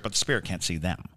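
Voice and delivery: ordinary talk, nothing marked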